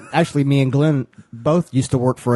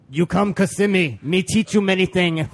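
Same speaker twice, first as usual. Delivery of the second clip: higher-pitched broken English, put-on German accent; Reiner, German accent; 6 semitones higher than usual. German man inviting a coworker to Kissimmee, mock accent. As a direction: imitate a German speaker with barely any English. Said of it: "the only English he could say was".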